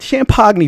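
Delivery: "champagne" said as sham-POG-nee; mock mispronunciation. Shown as a demonstration. Bragging about the private area, "champagne" comically said sham-pog-nee. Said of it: "I can't even pronounce it".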